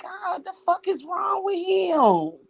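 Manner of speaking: high, slow, shouted disbelief; mock shocked outburst. Retelling her shock at Mike, high slow shout. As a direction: yell it in stunned disbelief. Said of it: "I was like".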